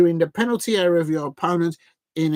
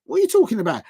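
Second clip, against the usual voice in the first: mock confused player; 4 semitones higher than usual. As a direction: play dumb like a cartoon dog. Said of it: "He's like".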